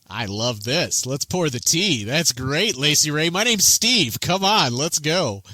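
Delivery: eager guard Steve introducing himself; excited friendly guard. Acted. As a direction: welcome them like old friends at a party. Steve greets them cheerfully.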